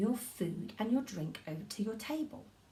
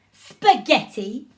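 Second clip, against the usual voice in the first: bright, louder, slowed happy demo; beaming happy spaghetti; 3 semitones higher, 10 dB louder, slower than usual. Shown as a demonstration. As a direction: say it like you're thrilled. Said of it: "like you are very, very happy".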